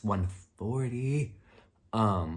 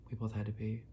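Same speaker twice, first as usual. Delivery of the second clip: softer, dropped repeat like an aside; 7 dB quieter than usual. Real, not acted. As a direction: say it again under your breath. Repeats the line for emphasis.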